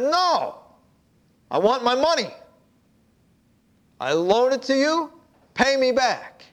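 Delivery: harsh demand. Acted. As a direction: grab him and demand the money. Master seizes him by throat.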